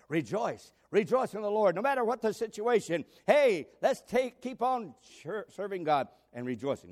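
rousing sermon cry; preaching exhortation. Acted. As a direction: urge the church to rejoice. Preacher urging rejoicing.

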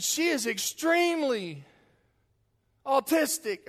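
high, loud and slow, full of excitement; exhilarated announcing. Retold or imitated. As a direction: proudly proclaim it high and loud. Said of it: "said it with so much exhilaration".

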